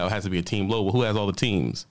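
ordinary talk, nothing marked

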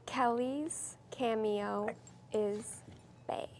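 slow, soft whisper, word by word; softly spaced-out words. Real, not acted. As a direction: whisper it slowly for a guesser. Repeats phrase for guesser.

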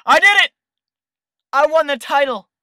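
loud, high victory shout; triumphant cheer. Real, not acted. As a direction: celebrate the win out loud. Winning the title.

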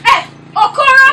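high, loud shout of his name; angry shout. Acted. Patron rebukes Okoro in a bar quarrel, shouting.

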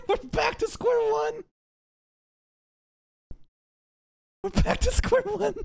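high laughing shout. Real, not acted. Streamer laughing at the looping dialogue, high shout. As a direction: cry it out through laughter.

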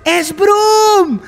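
rising panic each time; building alarm. Real, not acted. Gamer spotting Brom, alarm building to a cry.

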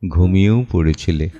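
ordinary talk

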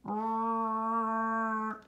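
long steady rrr; held R sound. Shown as a demonstration. Teacher and class holding a long rrr together. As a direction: hold the rrr. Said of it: "Make the R sound with me".

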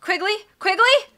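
high, rising hopeful call. A narrator's reading. Girl running to the taxi for her friend, calling loudly. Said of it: "and then she said it louder".

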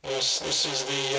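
usual speaking voice

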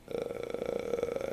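sleepy drawn-out uh; tired groan. Retold or imitated. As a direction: let a weary groan out. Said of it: "I'm really tired".

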